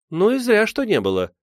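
put-on young Liza teases the admirer, ringing and high. A narrator's reading. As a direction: fling it out, ringing and cheeky. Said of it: "звонко сказала Лиза".

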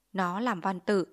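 ordinary delivery

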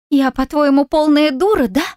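high, bitter, almost crying; spat out, near tears. A narrator's reading. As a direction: spit it out, close to tears. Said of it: "выплюнула она, чуть не плача".

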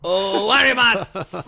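slow drunken Japanese attempt; japanese learner accent. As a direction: call out broken Japanese. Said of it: "drunk trying to speak Japanese".

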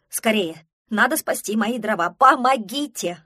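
loud cry for help; urgent plea. Acted. Пронырсон begs help saving his firewood, urgent shout.

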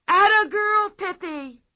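high, loud excited shriek; shrieking cheer. Acted. Children cheering Pippi in the ring, shrieking. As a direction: cheer her on at the top of your voice. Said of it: "shrieked Tommy and Anika".

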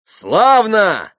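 high, loud, merciless gloating; malicious shout. A narrator's reading. Grushenka dismisses the pans, shouting mercilessly. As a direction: shout good riddance with spite. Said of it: "злобно и беспощадно крикнула опять Грушенька".

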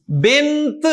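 بنت with a clearly released t at the end; final t sounded. Shown as a demonstration. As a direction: let the last t of بنت pop out audibly. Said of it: "تا کی آواز سنائی دے رہی ہے".

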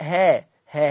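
ها with a plain h, demonstrated as wrong; wrong h sound. Shown as a demonstration. Teacher shows the mistaken h for the hamza. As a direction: say ها with a full h to show the error. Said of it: "ما قلتش ها".

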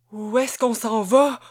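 voix tremblante, plus forte; tremblotant. A narrator's reading. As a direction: otage effrayé qui questionne son ravisseur. (question tremblante de peur)

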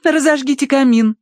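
high, haughty order. A narrator's reading. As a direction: command a servant offhand. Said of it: "велела тискающая кошку Тосси".

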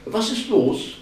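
high, loud, cheery question; cheerful. A narrator's reading. Lien arrives at the door, cheerful. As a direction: pipe up brightly and curiously. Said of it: "fragte sie fröhlich".

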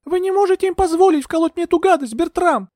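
very high, pleading protest; high-pitched, urgent woman's voice; desperate protest. A narrator's reading. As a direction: appeal to him in high alarm. Heroine begs not to be injected.

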